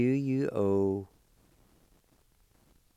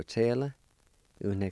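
normal talking voice

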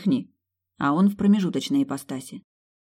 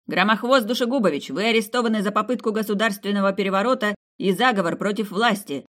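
official arrest; 5 semitones higher than usual. Acted. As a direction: announce the arrest with authority.

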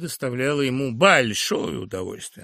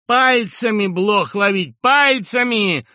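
higher, louder, slow angry bark; furious shout; 8 semitones higher, 10 dB louder, slower than usual. Acted. Professor yells at Sharikov for catching fleas. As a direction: yell it, enraged. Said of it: "яростно крикнул".

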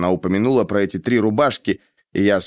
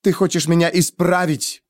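higher-pitched angry accusation; cutting retort; 7 semitones higher than usual. A narrator's reading. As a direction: shut him down resentfully. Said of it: "отрезала Денна". (обиженно отрезая)

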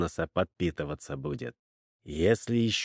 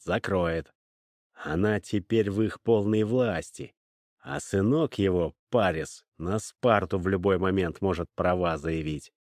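usual speaking voice

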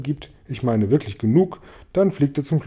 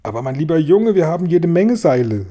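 exclaimed, higher and louder; calling out; 4 semitones higher, 6 dB louder than usual. A narrator's reading. Grasshopper telling James they have plenty of rope, calling out. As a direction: build upward in a protesting call. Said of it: "rief Grashüpfer".

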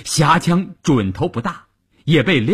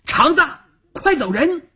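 very high, piercing call; shrill shouting. Acted. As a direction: shout an urgent order in a high, strained voice. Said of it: "尖着嗓子喊". (尖着嗓子喊)

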